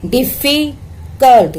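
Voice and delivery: "डिफिकल्ट" slowly, broken before the last syllable; split into syllables. Shown as a demonstration. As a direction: break "डिफिकल्ट" as डिफी, कल्ट. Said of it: "देखो कितने हैं". ("डिफिकल्ट" को धीरे, टुकड़ों में तोड़कर)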